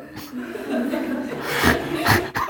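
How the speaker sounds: airy, high amused laugh; breathy high laugh. Real, not acted. Preacher laughing at Peter's foolishness, breathy and high. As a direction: let a silent giggle escape.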